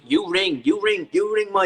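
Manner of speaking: mock Thai-accented English, insistent and repetitive; put-on Thai accent. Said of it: "I'm doing the accent".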